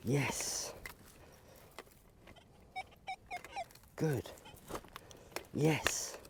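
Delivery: breathy whisper of encouragement; whispered praise. Real, not acted. Trainer encouraging her digging dog, whispered praise. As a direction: praise him softly so he keeps digging.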